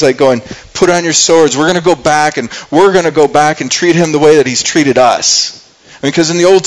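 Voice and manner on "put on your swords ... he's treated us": ordinary delivery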